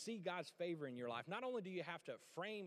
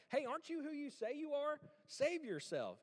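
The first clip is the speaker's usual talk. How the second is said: mocking criminal; 11 semitones higher, 4 dB louder than usual. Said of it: "insults at him and telling him".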